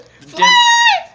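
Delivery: high shrieking burst of laughter. Real, not acted. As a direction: let out a squealing laugh.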